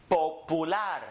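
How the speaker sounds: popular as po-pu-LAR, pure vowels; Spanish end stress. Shown as a demonstration. Teacher demonstrates a word ending in R, popular stressed on LAR. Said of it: "we're gonna go up on the N".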